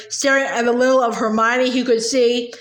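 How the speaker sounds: plain reading voice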